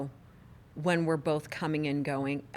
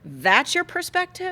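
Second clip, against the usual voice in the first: high, louder, drawn-out disbelieving question; incredulous retort; 11 semitones higher, 5 dB louder, slower than usual. Retold or imitated. Wife re-enacting her reply to husband's halfway-to-ninety remark, incredulous. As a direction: throw it back slowly in high-pitched disbelief.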